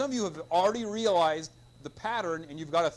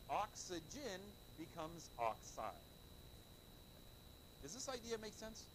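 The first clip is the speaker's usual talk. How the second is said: usual speaking voice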